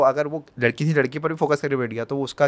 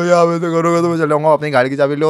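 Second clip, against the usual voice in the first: higher, louder whining through tears; mock tearful protest; 4 semitones higher, 6 dB louder than usual. Storyteller mimicking boy storming off in tears, mock tearful protest. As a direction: blubber it like a wounded quitter. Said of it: "रोते रोते चले गया".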